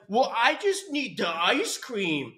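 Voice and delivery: high, slow put-on whine; mocking dieter voice. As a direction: drag out a mock-needy craving. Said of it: "that's not really what he said".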